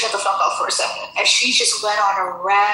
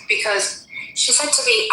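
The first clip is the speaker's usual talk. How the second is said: ordinary delivery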